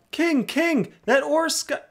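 excited. Real, not acted. Excitedly calling to the boss.

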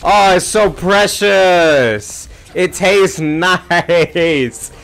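high, loud, drawn-out sarcastic delight; hammed-up fake joy, slow and shrill-free; mock gushing. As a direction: gush over it like it's adorable, dripping sarcasm. Sarcastic gushing after being killed.

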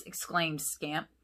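plain reading voice

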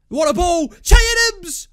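real fan's explosive match shout; excited shout. Real, not acted. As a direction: yell it high and loud at the chance. Live reaction to a big chance.

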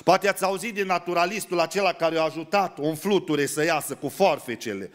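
ordinary delivery

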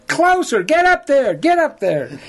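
high, loud coaxing commands; urging shout. Retold or imitated. Guide shouts at the hunter to get closer. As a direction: push him closer, calling out. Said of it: "I go".

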